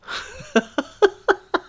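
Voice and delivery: high burst of real laughter. Real, not acted. Reader cracks up after finishing the story, high real laugh. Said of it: "this one makes me laugh".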